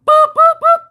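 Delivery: high, loud imitated beeping; mock car alert beeps; car warning beeps. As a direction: beep like a dashboard alarm. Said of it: "my car is like".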